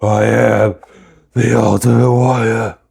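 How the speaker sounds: loud, hammed-up wrestler impersonation; Ultimate Warrior. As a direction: bellow it like a badly imitated wrestling promo. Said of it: "That was a really bad impersonation".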